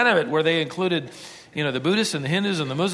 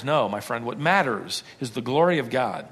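usual speaking voice

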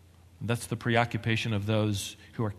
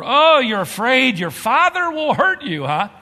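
higher and louder mocking taunt; sarcastic; 13 semitones higher, 10 dB louder than usual. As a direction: taunt her, sneering and loud. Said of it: "responded sarcastically".

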